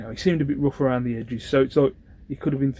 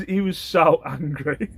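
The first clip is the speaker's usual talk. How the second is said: ordinary delivery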